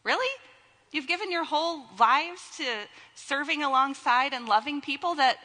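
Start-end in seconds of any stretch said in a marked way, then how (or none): 0.0-0.3 mock surprise. Teasing the congregation.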